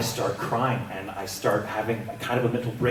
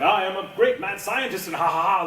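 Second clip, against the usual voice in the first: mad scientist; 7 semitones higher than usual. Acted. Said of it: "up until now has been like".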